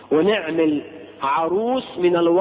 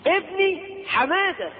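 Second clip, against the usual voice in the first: mother calling son; 9 semitones higher than usual. Retold or imitated. Imitating the mother calling her son.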